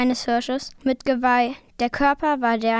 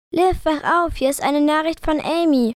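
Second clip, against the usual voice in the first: higher, louder excited call; eager, raised-voice nudge; urgent wake-up call; 5 semitones higher, 5 dB louder than usual. A narrator's reading. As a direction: rouse a sleeping friend. Waking a sleeping friend.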